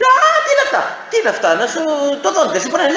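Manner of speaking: very high, laughing alarmed cry; hammed-up fright at the pliers; mock panic. Patient alarmed at the dentist's tools, mock panic.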